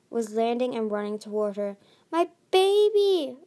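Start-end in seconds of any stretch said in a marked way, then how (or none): 2.1-3.4 high, loud, drawn-out cry; joyful cry. A narrator's reading. Queen Coral running toward her stolen daughter, crying out. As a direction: cry out to a long-lost child. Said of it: "Coral cried".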